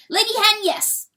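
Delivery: high, loud confirmation; insistent weasel. A narrator's reading. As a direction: throw it back at her, loud and high.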